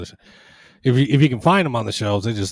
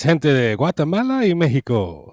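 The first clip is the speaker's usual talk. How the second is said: higher-pitched, drawn-out accented Spanish; accented Spanish greeting; 5 semitones higher, 4 dB louder, slower than usual. Shown as a demonstration. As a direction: show off your accented Spanish to the viewers. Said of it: "It's just my accent. It comes out".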